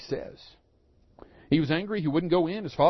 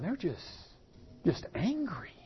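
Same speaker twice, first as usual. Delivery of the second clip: unvoiced whisper of the word angry; hushed aside about TV preachers; 9 dB quieter than usual. Real, not acted. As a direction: lean in and whisper it.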